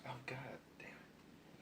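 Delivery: a muttered curse under the breath; whispered curse. Real, not acted. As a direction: swear to yourself in a hushed whisper. Whispered reaction to pun.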